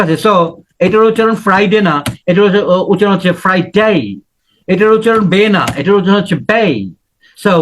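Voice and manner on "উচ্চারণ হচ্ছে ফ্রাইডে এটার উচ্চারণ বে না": ordinary talk, nothing marked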